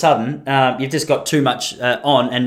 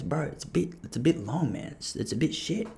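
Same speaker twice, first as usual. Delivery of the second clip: quiet, confidential gangster impression; 14 dB quieter than usual. Comedian pulls a mate aside to advise him, hushed gangster voice. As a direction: imitate a gangster giving advice under his breath. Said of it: "a little more gangster than he is".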